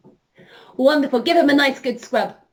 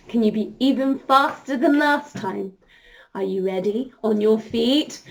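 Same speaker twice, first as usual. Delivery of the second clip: usual speaking voice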